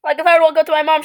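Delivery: usual speaking voice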